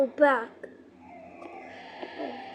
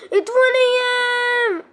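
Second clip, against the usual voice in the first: scared cry; 10 semitones higher, 10 dB louder, faster than usual. Real, not acted.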